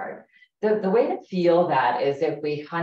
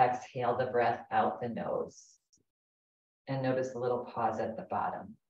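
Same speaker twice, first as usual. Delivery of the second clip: guided meditation. Acted. Leads a guided breathing meditation.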